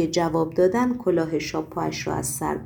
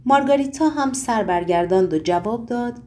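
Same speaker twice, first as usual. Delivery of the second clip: ordinary delivery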